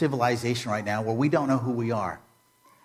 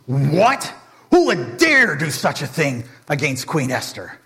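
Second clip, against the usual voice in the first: mock furious; 2 semitones higher, 4 dB louder than usual. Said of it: "Xerxes is furious".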